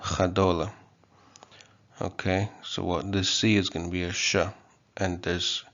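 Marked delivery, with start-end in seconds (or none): 0.0-0.7 "Chadola" opening on a throaty ch; Kotava pronunciation. Shown as a demonstration. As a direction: say "Chadola" as kha-DO-la. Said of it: "you see the ch".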